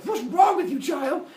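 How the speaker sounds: high, loud mock outrage; appalled father's scolding. Zeus appalled at son's confession.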